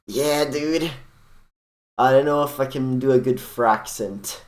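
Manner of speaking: breezy surfer-dude celebrity. Acted. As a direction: play the easygoing celebrity dude. Engard voiced.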